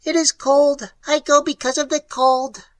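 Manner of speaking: high, clicking alien voice; clicking creature voice. A narrator's reading. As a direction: click it out from the burrow. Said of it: "The clicking voice drifted out".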